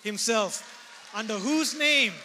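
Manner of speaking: preaching with rising force; full-voiced pulpit proclamation; fervent preaching. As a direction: preach it out to the congregation.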